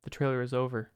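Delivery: quiet, bleak end-of-the-world monologue; hushed, hammed-up existential despair; mock desolate. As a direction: murmur it softly as if left in an empty void. Mock-bleak monologue after trailer ends.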